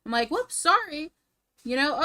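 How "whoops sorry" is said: mock apology. Mocking a careless guy's apology.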